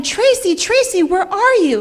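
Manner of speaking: high, loud calling shout. A narrator's reading. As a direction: yell her name across the lake. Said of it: "shouting, shouting".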